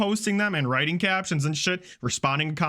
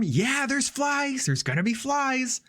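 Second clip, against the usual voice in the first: high, exasperated mock retort; defensive retort; 10 semitones higher, slower than usual. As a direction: imitate her indignant comeback, pitched high. Acted defensive reply to commenters.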